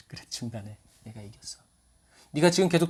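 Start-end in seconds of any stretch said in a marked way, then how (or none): 0.1-1.6 soft reply. Retold or imitated. Jesus answering softly.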